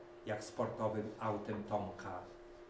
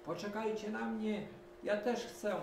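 much higher eager duck call; 12 semitones higher than usual. A narrator's reading. Duck Kwaczka running up, calling high. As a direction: call after them like a little duck. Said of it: "wołając".

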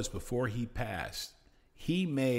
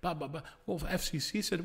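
ordinary talk

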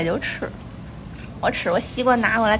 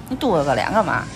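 normal talking voice

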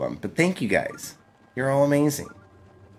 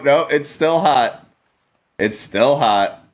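ordinary talk

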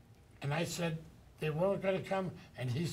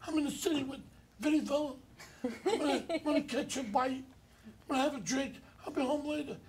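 much higher put-on voice for an old friend; high, teasing impression of Joe on the phone; imitation of Joe Regano; 10 semitones higher than usual. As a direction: pitch way up to mimic Joe. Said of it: "I said, uh".

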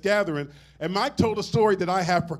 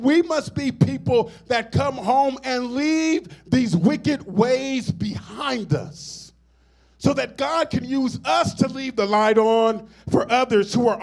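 higher, louder, slow preaching cadence; preaching at full force; 4 semitones higher, 4 dB louder, slower than usual. Acted. Pastor urging the church to leave the light on, rising into full preaching. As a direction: stretch the words and lift them to the congregation.

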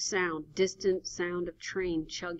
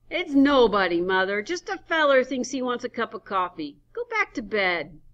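put-on grumpy old man; 6 semitones higher, 9 dB louder than usual. Henry answering his wife.

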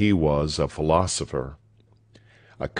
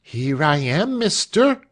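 high character voice, rising at the end; eager servant woman, pitched far up; maidservant calling back; 8 semitones higher than usual. A narrator's reading. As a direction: answer from up a ladder in a high voice. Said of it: "said a voice from one of the ladders".

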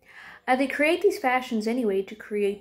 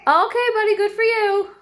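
high excited praise; 12 semitones higher, 8 dB louder than usual. Real, not acted. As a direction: cheer the little one on, high and loud. Praising a child mid-lesson.

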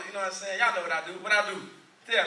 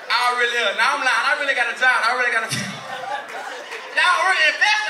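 louder laughing shouts; 7 dB louder than usual. Real, not acted. Comic to crowd, laughing and shouting.

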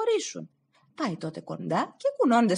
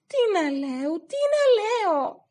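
much higher, pleading cry; desperate plea; 13 semitones higher, 3 dB louder than usual. Acted. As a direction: wail the question. Stavros pleads again.

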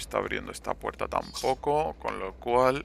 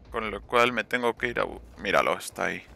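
ordinary talk, nothing marked

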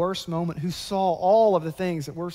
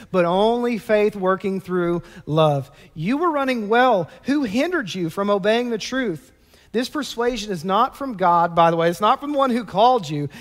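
louder, higher reading of scripture; forceful preaching; 3 semitones higher, 7 dB louder than usual. Acted. Preacher reading Galatians to the church, louder and emphatic. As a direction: drive Paul's words home.

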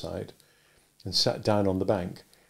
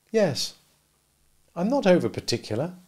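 put-on higher young woman, gently doubtful; Biddy warning Pip off being a gentleman, higher voice; Biddy's voice; 7 semitones higher than usual. A narrator's reading. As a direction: voice Biddy a good deal higher, kindly advising.

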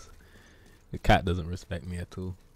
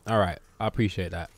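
ordinary delivery

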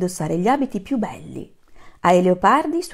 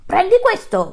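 much higher, louder throw line; king's shout; 12 semitones higher, 8 dB louder than usual. Acted. Lion king shouts as he throws the trunk, loud and high. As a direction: hurl it at the elephant. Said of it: "gridò il re".

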